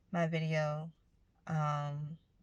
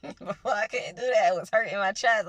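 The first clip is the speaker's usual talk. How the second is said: laughing; 4 semitones higher, 10 dB louder than usual. Real, not acted. Laughing about past breathing.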